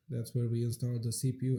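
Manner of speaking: ordinary talk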